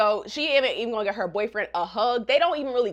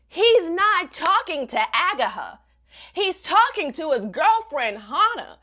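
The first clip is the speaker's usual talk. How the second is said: slow, loud, higher reveal; dramatic reveal; 5 semitones higher, 5 dB louder than usual. Real, not acted. Vlogger revealing the double cross to viewers, slow dramatic emphasis. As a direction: draw out the twist.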